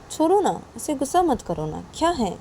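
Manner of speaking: high, swooping appeal; coaxing plea. Acted. Friend begs Nisha to drop her anger, coaxing. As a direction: wheedle her to let it go.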